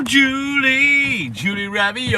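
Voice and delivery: high, playful call of a name; bright teasing hello; sing-song greeting. Real, not acted.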